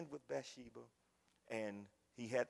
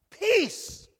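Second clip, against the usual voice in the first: high, loud, ringing preacher's call; preacher shouting that anybody can be saved; full-force preaching; 18 dB louder than usual. Acted. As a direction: hammer home whosoever to the church. Preaching climax.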